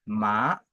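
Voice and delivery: "ma" starting low and rising; second tone. Shown as a demonstration. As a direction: lift "ma" steadily like a question. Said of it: "le deuxième ton".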